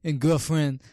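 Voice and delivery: loud, high tipsy aunt impression; drunk aunt voice. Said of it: "she sounded like a drunk aunt".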